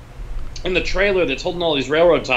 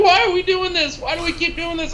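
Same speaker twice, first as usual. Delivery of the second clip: high, frantic cartoon whine; cartoon impression; 12 semitones higher than usual. Quoting Rick and Morty about the film shoot, high frantic whine. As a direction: squeal it like a panicked cartoon kid. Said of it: "that Rick and Morty episode when they're like".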